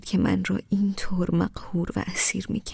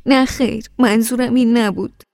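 tearful protest; 5 semitones higher, 7 dB louder than usual. A narrator's reading. Wife protesting, still tearful.

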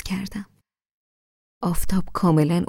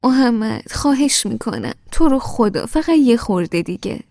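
higher, louder begging; pleading; 5 semitones higher, 5 dB louder than usual. A narrator's reading. Wife begging Mohammad to let her sleep, pleading. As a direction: whine for five more minutes. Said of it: "با التماس گفتم".

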